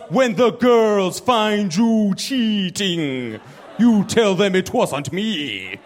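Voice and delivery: bad Jamaican accent. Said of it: "I gotta do a Jamaican accent".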